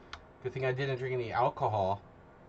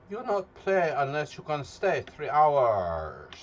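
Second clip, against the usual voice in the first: mock broken English; 2 semitones higher, 5 dB louder, slower than usual. Mock rewording of his stream rule.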